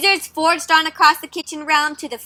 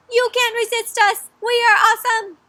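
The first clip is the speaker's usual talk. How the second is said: higher, playful nugget brag; mock chicken nugget voice; 7 semitones higher than usual. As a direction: puff up like boastful chicken nuggets. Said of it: "the chicken nuggets are like".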